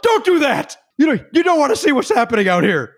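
high mock yell across a venue; retold yell from crowd. Fan yelling up at the stage in a mock yell. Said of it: "I literally yelled out".

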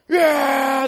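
huge whooping yell of the crowd; crowd cheer. Retold or imitated. Israelite army greeting the ark, a huge cheering shout. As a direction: belt it like a whole army cheering.

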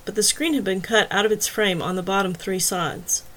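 plain reading voice

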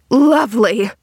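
loud, scornful echo of a compliment. A narrator's reading. Woman rejects the girl's compliment, scornfully. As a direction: throw the word back with scorn. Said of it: "scorned the woman".

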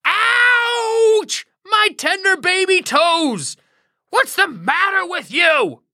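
high, whiny howl over a smashed toe; hammed-up yelp of pain. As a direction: wail like a pampered man who got hurt. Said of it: "he howls".

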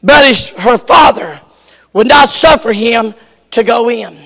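ordinary talk, nothing marked

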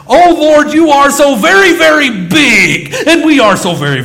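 Monty Python style booming prayer, mocking; mock pompous prayer. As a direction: intone it like an over-the-top prayer. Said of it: "an old Monty Python skit".

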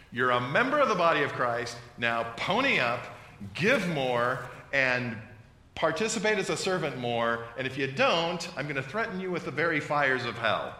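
loud, threatening pulpit demand; dysfunctional pastor. Retold or imitated. Preacher parodying a pastor who threatens givers, loud. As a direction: shake down the flock with hellfire. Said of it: "A dysfunctional pastor will come in front of you".

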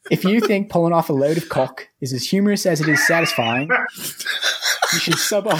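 put-on light Australian accent breaking into laughter; Aussie read that cracks up on the last words; mock Australian accent. Co-host reads the review's punchline in a mock Australian accent, laughing. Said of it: "Get in character".

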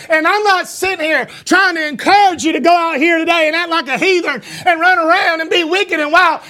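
ordinary talk, nothing marked

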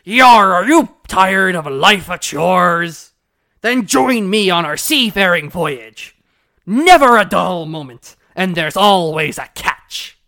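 loud, slow pirate captain. Retold or imitated. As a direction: bellow it like a sea captain. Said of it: "let me get my pirate voice in".

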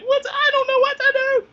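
loud, very high mock wail; hammed-up squeal of helpless panic; retold panic. Retelling frantic texts to sister, hysterical mock wail.

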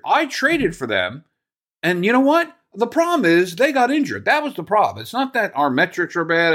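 high, whiny mocking imitation of the GM's excuses; mock Cashman voice. Podcaster mocking the GM's excuses, high whiny imitation. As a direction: sneer his excuses back at him in a put-on voice.

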